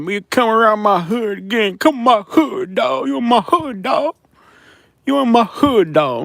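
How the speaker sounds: high, drawn-out mocking threat; mock a street heckler in a high, stretched voice. Streamer mimics a death threat from Tuskegee, mocking high-pitched tough guy. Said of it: "They said".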